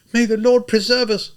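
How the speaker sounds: a woman crossing herself; pious woman. A narrator's reading. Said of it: "a woman said".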